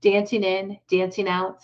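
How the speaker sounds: ordinary delivery, nothing marked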